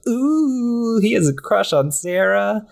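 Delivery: put-on high, singsong teasing, like kids ribbing a friend; high-pitched schoolyard taunt; teasing ooh. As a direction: tease him about his crush in a high voice. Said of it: "And they're like".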